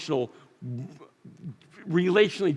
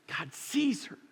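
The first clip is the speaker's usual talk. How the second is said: tender emphasis; 6 semitones higher, 8 dB quieter, slower than usual. Real, not acted. Preacher softens on key truth.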